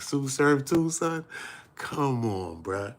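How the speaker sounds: a high incredulous plea; high disbelief. Retold or imitated. Reacting to news about Sue Surf, high disbelief. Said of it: "I'm like".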